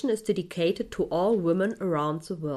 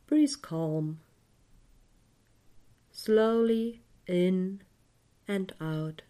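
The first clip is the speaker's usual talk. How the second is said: guided meditation. Acted. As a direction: guide the listener gently into calm.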